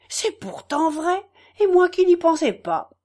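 brightening as it dawns on her; excited realisation. Acted. Farmer's wife realising her husband is right, excited.

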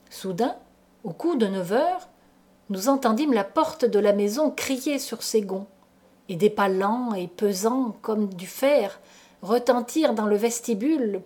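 ordinary delivery

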